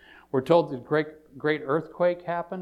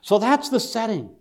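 emphatic, raised pulpit voice; full-force preaching; 4 semitones higher, 7 dB louder than usual. Real, not acted. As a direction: proclaim the scene with stretched key words.

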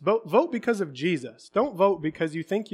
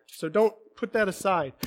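ordinary talk, nothing marked

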